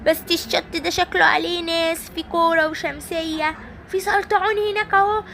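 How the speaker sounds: high, whimpering, hammed-up sobs; mock crying toy. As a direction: wail about being lost, high. Simba alone, fake crying. (بكاء تمثيلي بصوت عالٍ)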